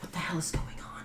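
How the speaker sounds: hissed whisper. Acted. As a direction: whisper it in alarm. GM voices startled NPC at night.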